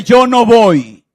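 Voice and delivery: loud, very slow defiant refusal; loud, slow stubborn no; Jonah's refusal. Acted. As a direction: dig in and refuse. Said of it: "Y Jonás le dice".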